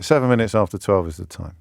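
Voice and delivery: ordinary talk, nothing marked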